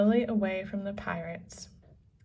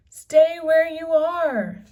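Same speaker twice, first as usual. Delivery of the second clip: loud, high and slow; shouted warning; 8 semitones higher, 11 dB louder than usual. A narrator's reading. Jack warning Annie off the ladder, loud high shout. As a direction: yell it down to Annie. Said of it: "Jack shouted".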